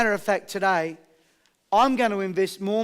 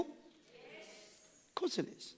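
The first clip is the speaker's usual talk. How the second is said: whisper; 4 semitones higher, 24 dB quieter, much slower than usual. Real, not acted. Aside answering his own question.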